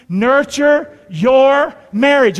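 very slow, loud, word by word; call-and-response. Shown as a demonstration. Preacher leading the congregation to repeat, slow loud call-and-response. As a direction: spell out each word for a crowd to repeat. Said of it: "Would you say that with me".

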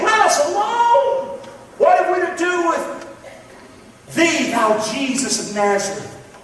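high, slow, strained demon cry. Acted. Preacher voicing the demon crying out to Jesus, high and drawn out. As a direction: let the unclean spirit wail it out. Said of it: "he cried out with a loud voice".

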